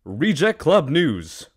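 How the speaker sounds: big TV-news introduction; mock news anchor. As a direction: announce the segment like a TV news host. Mock news host introducing segments.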